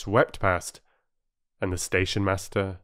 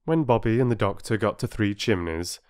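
plain reading voice

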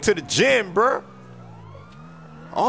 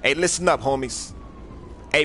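usual speaking voice

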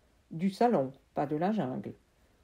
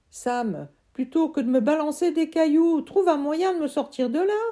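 put-on higher, loud boy's voice; trapped friend; 9 semitones higher, 7 dB louder than usual. Friend trapped in the tree urging Sam, high loud voice. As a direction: call out from inside the trunk.

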